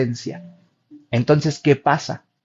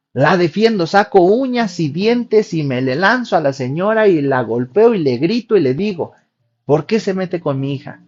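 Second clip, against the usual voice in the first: fierce mock defense; 4 semitones higher, 5 dB louder than usual. Patient acting out defending daughter.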